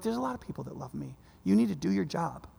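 soft, hushed voice of God reassuring him; gentle whisper. Retold or imitated. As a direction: say it low and quiet, like a private word. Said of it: "he whispers to him".

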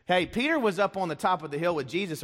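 ordinary delivery

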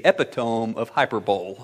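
"epitome" as EP-i-tome, "hyperbole" as HY-per-bowl; "epitome" rhyming with home, "hyperbole" ending in bowl; comic mispronunciation. Retold or imitated. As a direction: read "epitome" and "hyperbole" as spelt, with silent final e. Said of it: "you mispronounced every word".

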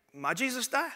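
put-on high little toddler voice, rising; small child's question. Three-year-old son asks dad at Easter pageant, childlike. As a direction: imitate a small boy asking in bewilderment. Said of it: "and he said".

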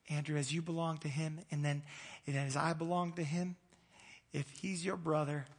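ordinary talk, nothing marked

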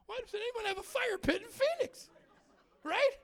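very high-pitched incredulous question; exasperated disbelief. Real, not acted. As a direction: squeal it in comic exasperation. Exasperated rant about fire pit.

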